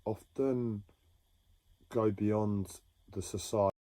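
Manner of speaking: usual speaking voice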